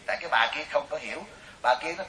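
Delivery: ordinary delivery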